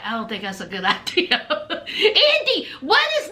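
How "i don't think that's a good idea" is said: low, quick, soft mumble; mock mumbling with a laugh breaking in; Harry's mumble. Host retelling a boyfriend's refusal, mock mumbled. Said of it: "So here he is mumbling".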